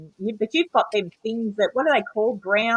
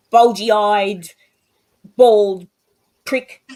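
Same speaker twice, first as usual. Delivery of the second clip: louder, spat-out name-calling, paused between words; mock insult; 8 dB louder, much slower than usual. Imitating trolls insulting her father, louder mock jeering. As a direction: hurl each insult like a troll. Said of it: "that they called".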